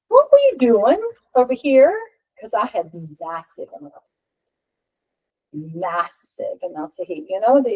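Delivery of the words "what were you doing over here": high, loud retold disbelief; acted incredulous question. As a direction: demand it as if startled, pitched up. Retold startled question to therapist.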